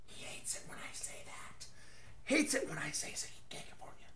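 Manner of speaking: conspiratorial whisper, almost no voice; hushed, sly whisper to the camera; whispered aside. Real, not acted. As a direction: lean in and whisper it like a secret. Whispered aside about his brother.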